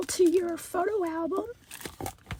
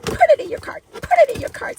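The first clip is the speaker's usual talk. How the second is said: excited; 11 semitones higher, 13 dB louder, much faster than usual. Real, not acted. Urging viewers to buy.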